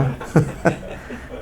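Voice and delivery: soft breathless laugh; airy giggle. Real, not acted. Teacher giggling softly at his joke, airy. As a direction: giggle quietly through the breath.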